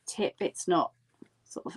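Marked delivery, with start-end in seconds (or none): 1.5-1.8 whispering. Real, not acted. Whispered aside while demonstrating.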